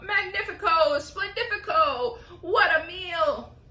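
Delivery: loud gleeful cry; excited cry. Acted. Aunt crying out over the peach, gleeful. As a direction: cry it out with glee.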